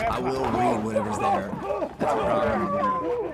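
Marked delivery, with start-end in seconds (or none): 1.2-3.3 high silly voice. Acted. Reads teleprompter in a put-on voice.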